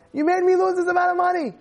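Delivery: high, fast mock outrage; imitated angry rant, quick and high; flipped out. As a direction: hurl an acted accusation, pitched high. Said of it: "the guy flipped out".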